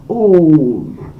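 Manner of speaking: Oom let out flat and small; a deflated little Oom; a firework fizzling out; understated. Shown as a demonstration. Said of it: "a really understated reaction to a firework".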